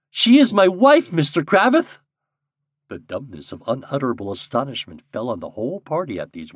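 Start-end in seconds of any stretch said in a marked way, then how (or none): 0.1-2.0 high, loud, emotional outburst; urgent, overwrought declaration; gasped. A narrator's reading. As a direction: blurt out a shocking confession.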